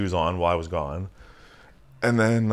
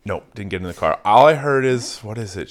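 normal talking voice

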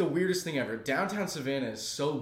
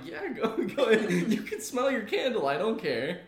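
higher, laughing through the words; amused reply; 6 semitones higher, 4 dB louder than usual. Retold or imitated. Answering the candle-crazed cashier, laughing along. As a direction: play along, barely holding the laugh. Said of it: "I was like".

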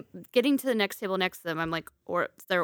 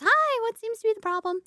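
much higher, sugary customer-service voice; sweet manager voice; 13 semitones higher than usual. Retold or imitated. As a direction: play the overly sweet manager greeting. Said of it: "She goes".

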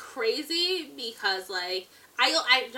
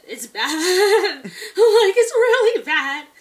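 high, loud pained laughter; laughing through pain; 7 semitones higher, 11 dB louder, slower than usual. Real, not acted. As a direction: wail it through helpless laughter. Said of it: "I'm laughing through the pain".

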